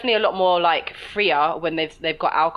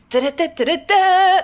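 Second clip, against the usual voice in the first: high, loud chirpy babble; mock peppy gabble; bubbly chatter noise; 12 semitones higher, 8 dB louder than usual. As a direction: mime overbright chatter. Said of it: "bright in your face like that".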